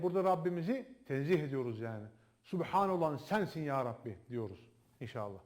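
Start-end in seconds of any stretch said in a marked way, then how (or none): none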